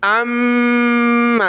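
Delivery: "ام" with a long hummed m; ghunnah. Shown as a demonstration. Teacher shows the doubled meem, "ام" hummed long. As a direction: hold the m of "ام" in the nose. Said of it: "تو غنہ ہوگا".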